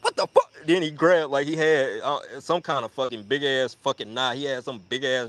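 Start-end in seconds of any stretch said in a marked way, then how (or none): 0.0-0.5 very high, louder mock alarm; hammed-up high shock; startled outburst. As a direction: imitate a startled friend squawking. Acts out startled friend.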